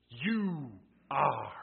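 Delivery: YOU and ARE each hit hard, separated by a pause; emphatic. Acted. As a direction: declare "You ... are" loudly, word by word.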